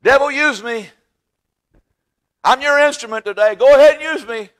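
high, loud mock plea to the devil; mocking sinner's cry. Preacher mocking those who give in to the devil, loud high mock plea. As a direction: ham up a soul selling itself.

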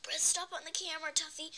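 ordinary talk

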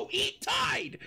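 high shout through hysterical laughter; laughing shout. Real, not acted. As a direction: cackle it out, losing it. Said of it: "I think you snapped".

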